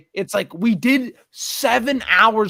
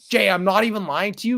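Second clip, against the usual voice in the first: normal talking voice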